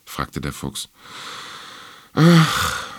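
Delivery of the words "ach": loud, high heaved sigh. A narrator's reading. Little star answering the fox's question, sighing. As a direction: sigh out the word, drawn out and high. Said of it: "seufzte der Stern".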